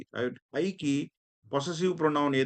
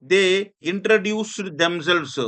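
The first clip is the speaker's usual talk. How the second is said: slow, louder and higher classroom reading; slow loud example reading; 4 semitones higher, 5 dB louder, much slower than usual. Shown as a demonstration. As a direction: project the example sentence slowly. Teacher reading example sentence. (నెమ్మదిగా గట్టిగా ఉదాహరణ చదవడం)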